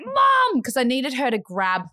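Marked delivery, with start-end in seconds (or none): 0.0-0.6 high-pitched yell for mum; retold scream. Daughter screaming for mum to take baby, urgent. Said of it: "screaming at the top of my lungs".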